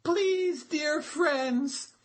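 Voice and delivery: high, slow, begging little turtle; pleading turtle. A narrator's reading. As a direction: beg your friends not to leave you. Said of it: "Turtle was very upset and cried".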